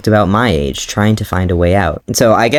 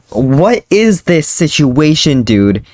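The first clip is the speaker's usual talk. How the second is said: slow, high and baffled; mock bafflement; 6 semitones higher, slower than usual. Real, not acted. Baffled by her own story, she drawls in disbelief. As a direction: drag it out in disbelief.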